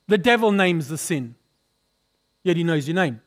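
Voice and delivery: ordinary delivery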